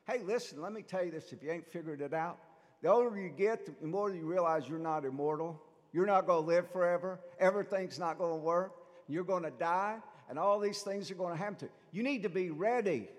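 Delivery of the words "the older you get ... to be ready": louder, driving sermon voice; full-force preaching. Acted. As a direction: hammer the warning home to the flock.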